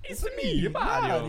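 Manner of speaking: high squeaky cartoon plumber; Mario voice. Asked for a Mario voice, high cartoon impression. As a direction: pitch way up like the game hero. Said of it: "Mario voice?".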